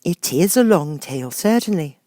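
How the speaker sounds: put-on puzzled young girl; lightly voiced Alice.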